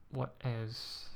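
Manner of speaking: usual speaking voice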